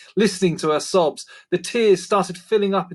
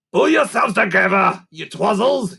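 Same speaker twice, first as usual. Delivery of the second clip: louder, scolding creaker voice; mock stern hiss; 6 semitones higher, 5 dB louder than usual. A narrator's reading. Grunt scolding the giggling creakers, in a loud stern creaker voice. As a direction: scold them like a cross goblin. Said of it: "hissed Grunt".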